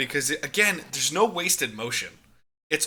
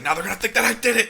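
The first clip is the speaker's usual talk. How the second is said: Rey's frantic panic; 9 semitones higher, 4 dB louder, much faster than usual. Retold or imitated. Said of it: "physical comedy of Rey".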